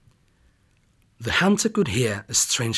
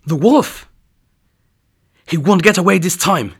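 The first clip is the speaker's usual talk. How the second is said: loud, higher triumphant cry; excited exclaim; 6 semitones higher, 7 dB louder than usual. Acted. Hunter spots the wolf through the window, loud excited cry. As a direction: finally caught him, cry it out.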